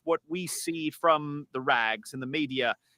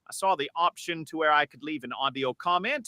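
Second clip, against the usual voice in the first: AI-converted English voice; British caller voice; English accent. Acted. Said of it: "But this is me".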